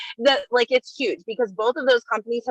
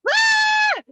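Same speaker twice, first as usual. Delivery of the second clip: louder, then a very high squeal on ah!; excited squeal; 4 dB louder than usual. Real, not acted. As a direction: break into a thrilled shriek. Excited product announcement.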